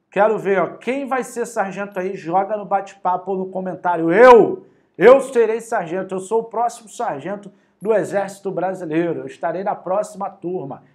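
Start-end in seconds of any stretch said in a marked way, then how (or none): 4.1-10.8 voicing a student who proudly claims the next sergeant spot, higher and louder at the start; eager student answer. Teacher voices a student's comment, proud and eager. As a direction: announce it like a proud recruit. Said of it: "É isso que eu quero de vocês".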